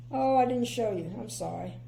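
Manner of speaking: ordinary delivery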